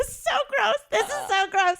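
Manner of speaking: high, laughing disgust. Real, not acted. Recalling the gross moment, laughing squeal of disgust.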